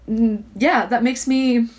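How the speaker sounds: usual speaking voice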